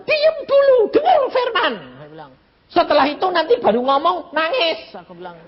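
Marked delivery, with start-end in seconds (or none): 0.0-1.7 high, raised-voice order to hush; acted exasperated call for silence; loud scolding command. Preacher to crying woman during sermon, scolding loudly. 2.7-4.9 high, raised-voice telling-off; acted exasperated instruction; scolding. Preacher to crying woman during sermon, scolding.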